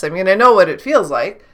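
ordinary talk, nothing marked

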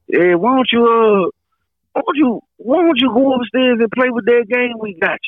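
high, sly offer; high Marvin voice. Retold or imitated.

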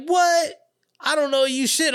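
very high-pitched imitated protest; retold incredulous comeback; mock indignant denial.

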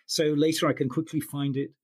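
ordinary delivery

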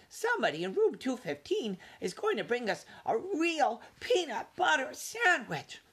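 high, eager little mouse; squeaky young mouse, excited. A narrator's reading. Ralph's excited news.

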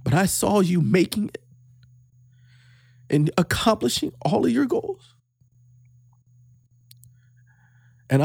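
real emotion, voice half-whispered and catching; fighting back tears while telling his son he's proud; choked up. Real, not acted. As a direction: voice breaking with real pride. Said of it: "I get a little emotional".

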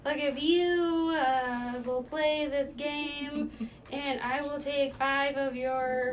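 slow, high put-on hustler voice; mock dealer voice. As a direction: drawl it like a shady card dealer. Mock gambling pitch voice.

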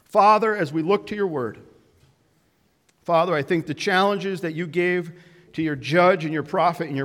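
loud, earnest prayer from the pulpit; opening strong, addressing God; pastoral prayer. Acted. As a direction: lead the church in fervent prayer. Pastor leads closing prayer.

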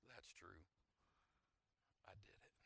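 hushed, resigned owning up; subdued admission. Retold or imitated. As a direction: own up softly, with a pause between. Acts out confessing to dean.

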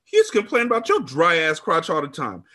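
high-pitched, heated bragging; spiteful taunt. A narrator's reading. Friend brags about lover to narrator, taunting.

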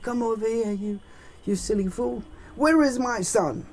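high-pitched, blustering rich-man voice; hammed-up, imperious father; bossy rich father. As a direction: order the nurse about in a high, haughty voice. Father orders nurse around.

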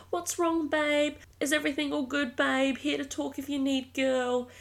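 high, mocking girlfriend-comment voice; mock sympathetic commenters. Imitating friends in the comments, mock sweet and high. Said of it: "gonna get comments of like".

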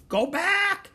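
very high, loud bird cry; parrot squawk. A narrator's reading. Parrot warning those below, squawking. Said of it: "squawked Polly".

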